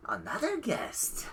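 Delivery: slow, hushed mysterious whisper; whispery old fortune teller. Acted. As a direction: breathe it like an old seer. Old fortune teller greets guest.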